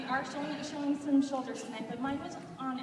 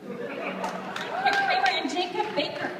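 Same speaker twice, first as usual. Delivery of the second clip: laughing; 4 semitones higher, 8 dB louder, much slower than usual. Real, not acted. Announcing performers amid laughter.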